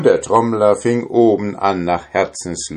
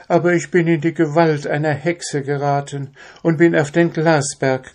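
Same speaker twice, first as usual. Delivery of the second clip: princess pleading; 9 semitones higher than usual. A narrator's reading. Captive princess begs the drummer for her shirt, high and pleading. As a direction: plead gently like a captive king's daughter.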